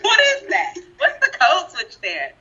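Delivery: high-pitched question through laughter; amused, squeaky bafflement; laughing disbelief. Real, not acted. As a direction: ask it incredulously while cracking up. Laughing at the imitated voice switch.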